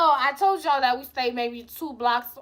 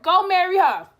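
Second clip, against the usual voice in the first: louder, sharp go marry her; snapping back; 6 semitones higher, 8 dB louder than usual. Retold or imitated. Telling boyfriend to marry the other girl, sharp retort. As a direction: fire it back at him. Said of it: "and I'm like".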